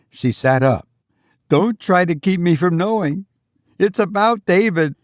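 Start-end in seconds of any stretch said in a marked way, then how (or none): 1.5-5.0 high, tense woman's voice; anxious mother voice. Acted. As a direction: voice the frightened mother, higher. Mother pleading.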